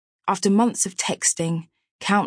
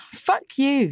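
laughing, pitched up high; 10 semitones higher than usual. Acted. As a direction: laugh it off playfully. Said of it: "she laughed".

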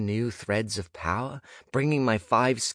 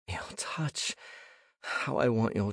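breathy, hushed whisper; whispered longing; 2 semitones higher, 5 dB quieter than usual. Acted. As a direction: breathe it out with aching want. Inner longing thought, breathed.

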